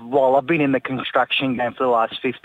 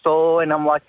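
ordinary talk

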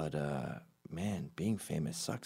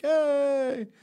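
much higher, loud shrill whoop; fan yelling from car; 8 dB louder than usual. Retold or imitated. Fan yells from a car window, high and loud. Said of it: "It's just like".

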